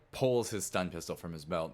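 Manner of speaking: ordinary delivery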